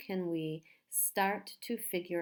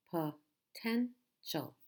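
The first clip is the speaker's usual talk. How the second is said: "potential" slowly split as po, ten, tial; syllable by syllable; much slower than usual. Shown as a demonstration. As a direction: pause between all three syllables of "potential". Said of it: "Three syllables".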